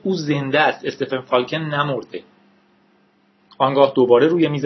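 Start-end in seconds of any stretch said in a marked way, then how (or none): none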